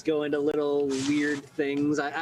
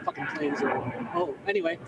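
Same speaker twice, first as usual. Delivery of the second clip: ordinary talk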